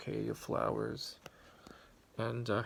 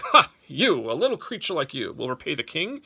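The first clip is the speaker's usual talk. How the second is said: amused king; 5 semitones higher, 11 dB louder than usual. Acted. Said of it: "burst out laughing".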